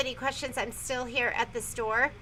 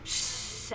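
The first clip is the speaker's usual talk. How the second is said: shushing sound; 8 dB quieter than usual. Retold or imitated. Mock shushing.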